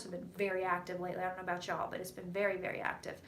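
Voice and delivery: fading to a whisper; hushed. Real, not acted. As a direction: drop to near whisper. Trails into hushed near-whisper.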